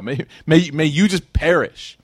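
retold curse. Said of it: "he actually cursed him".

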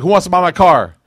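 loud, high call to the whole street; mock sales shout. Teacher acting out a market sale to the class, loud and high. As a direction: hawk the car like a market crier, loud and high. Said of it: "It'd be like if I went to your car and I was like".

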